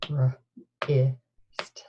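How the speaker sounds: R, I, st, each sound split apart; sounding out words. Shown as a demonstration. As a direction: segment wrist into R, I, st with pauses. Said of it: "say the sounds in wrist".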